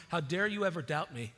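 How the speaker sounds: sarcastic indignant rant, hammed up; mock exasperated Jesus. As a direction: ham up a fed-up, impatient Jesus. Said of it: "that's not at all the tone".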